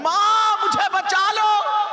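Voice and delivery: high, loud cry for help; girl screaming for mother. Retold or imitated. Preacher voicing the dying girl crying to her mother. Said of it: "चिल्ला रही है".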